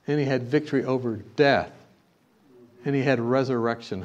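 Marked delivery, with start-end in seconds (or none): none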